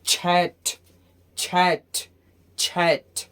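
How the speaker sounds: "छैठ" as one syllable with a released final t; model pronunciation. Shown as a demonstration. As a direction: drill "छैठ" as separate, clear words. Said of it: "क्या हो जाएगा?". ("छैठ" का साफ़, अलग-अलग उच्चारण)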